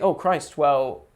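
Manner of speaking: ordinary delivery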